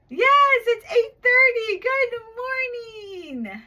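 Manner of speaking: high, loud, cheering praise; excited praise. Acted. Teacher cheering her young student's right answer, high and loud.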